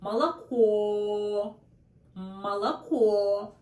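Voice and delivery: Молоко said slowly, malaKO, with a clear, drawn-out final O; Молоко drawn out slowly, the last O long and clear; slow model word. Shown as a demonstration. Teacher slows down so learners hear the stressed O. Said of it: "this is really clear O sound".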